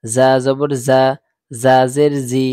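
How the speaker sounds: loud, clipped drill chant, syllable by syllable; loud letter drill. Shown as a demonstration. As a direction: call out each syllable loudly for the class to repeat. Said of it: "একদম তাড়াতাড়ি উচ্চারণ". (জোরে জোরে অক্ষর উচ্চারণের অনুশীলন)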